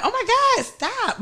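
high, soft playful protest; fake stop. Woman imitating her fake stop to a guy's hug, playful. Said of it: "But you, I want him really stop".

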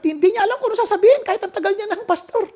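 high, loud, fast exclaim; emphatic loud. Acted. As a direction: blurt it out, marvelling. Stressing pastor's loss for words. (malakas at mabilis na pagdidiin)

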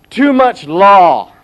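loud, slow, mocking the letter writers; mock complaint. Reading out a critic's letter, loud mock complaint.

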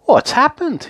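high-pitched worried question; anxious. A narrator's reading. Mister Happy notices her sad little face, anxious. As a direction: ask in sudden concern. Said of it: "he asked anxiously".

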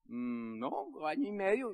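low, quiet hum into a slow reply; hesitate, drawing it out softly; hesitant answer. Retold or imitated. Newcomer answers veteran engineer's question, hesitant.

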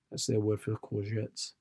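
normal talking voice

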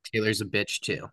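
ordinary delivery

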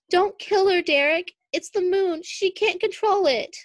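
high, loud desperate plea; pleading. A narrator's reading. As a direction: beg him urgently. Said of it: "As Derek pleaded with her".

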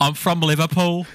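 a put-on Liverpool accent, lightly done; fake Scouse accent. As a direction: play a cocky Scouser greeting the lads. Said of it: "props to you, [name]. That was good".

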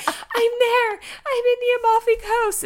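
high, loud, mock-crying yell; hammed-up strained cry; tearful labor wail. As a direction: wail it through a contraction. Labor cry answering coaching.

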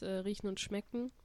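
usual speaking voice

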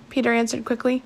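ordinary delivery

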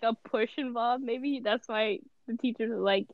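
usual speaking voice

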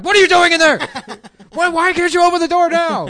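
full-volume, high-pitched yell; screaming mom. Retold or imitated. Mom outside the deadbolted door, furious mock scream. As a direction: scream through a bolted door, imitating mom. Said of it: "screaming through the whole".

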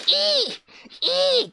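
high shrieking cry; Shocker grunt. Pretend. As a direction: screech like a minion villain. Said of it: "ショッカーかよ。".

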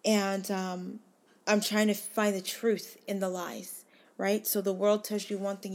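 normal talking voice

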